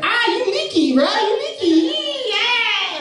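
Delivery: high-pitched taunting celebration; excited kid. Retold or imitated. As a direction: imitate a kid crowing after scoring. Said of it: "and gets real excited".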